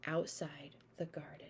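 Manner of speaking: a relieved whisper. Acted. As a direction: breathe it out as a hushed whisper. Safe at last, hushed.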